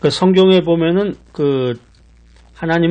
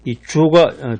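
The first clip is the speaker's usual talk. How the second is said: normal talking voice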